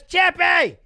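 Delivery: loud, high cheer of the name; excited shout. Real, not acted. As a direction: cheer Chepe like he just won. Hyping his favourite fighter.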